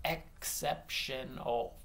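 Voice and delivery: "Exceptional" in slow, quiet, distinct syllables; counting syllables. Shown as a demonstration. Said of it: "count how many syllables".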